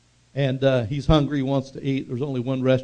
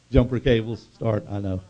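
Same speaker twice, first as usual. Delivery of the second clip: usual speaking voice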